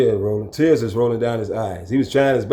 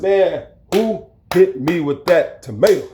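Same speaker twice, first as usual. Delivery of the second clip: slow, raised demanding call; demanding who hit him; 6 semitones higher, slower than usual. Retold or imitated. Retelling, he demands who threw the tomato. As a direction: call out the culprit sternly.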